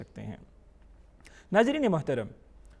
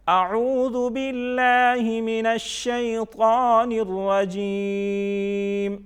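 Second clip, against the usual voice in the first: slow, higher, louder chant in one flow; joined-up recitation; 4 semitones higher, 4 dB louder, much slower than usual. Shown as a demonstration. Quran teacher reads it together for pupils, flowing chant. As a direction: recite it all connected, holding long vowels. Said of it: "اب ہم اس کو اکٹھا پڑھتے ہیں".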